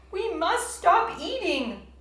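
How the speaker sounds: high, loud and slow plea; cried. A narrator's reading. Toad cries out to Frog, high, loud and slow. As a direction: wail it out in mock despair. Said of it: "cried Toad".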